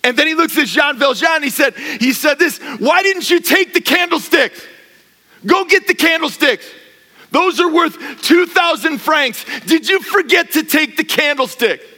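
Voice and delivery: the bishop, loud and high, urging the candlesticks on him; a preacher voicing a generous bishop at full force; bishop's insistent plea. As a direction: press the gift on him, voice lifting loud and high. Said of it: "the bishop looks at the soldiers and says".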